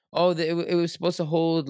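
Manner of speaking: high, whining excuse; whiny trader. Retold or imitated. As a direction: complain like a stunned trader. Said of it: "No one is allowed to say".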